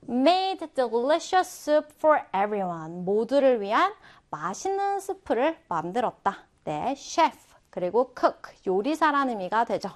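normal talking voice

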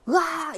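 high-pitched burst of pretend rage; mock angry yell. Teacher imagines herself venting at someone, mock angry yell. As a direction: let out a brief hammed-up "aargh". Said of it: "이렇게 화를 냈어".

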